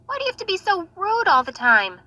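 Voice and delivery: scolding. Acted. Sister scolding Natasha.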